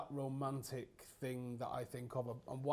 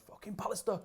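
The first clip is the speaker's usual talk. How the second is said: mock furious yell, higher; retold angry yell; 8 semitones higher than usual. Fergie blasting Pallister after the match, in a mock yell. Manager rants in dressing room.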